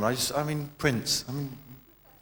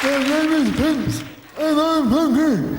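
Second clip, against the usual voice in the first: much higher, slow and louder falsetto; 13 semitones higher, 4 dB louder, slower than usual. Pretend. Prince answers in character.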